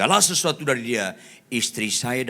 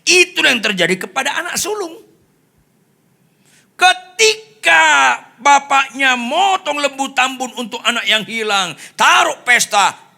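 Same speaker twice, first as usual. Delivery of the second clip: full-force preaching; 13 dB louder than usual. Real, not acted. Preacher retelling the prodigal son, full force. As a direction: belt out the parable, stretching key words.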